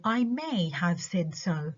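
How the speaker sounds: "may" lifted and drawn out; stress on MAY. Shown as a demonstration. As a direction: lean on "may" as a concession. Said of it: "بعمل stress على ال auxiliary verb".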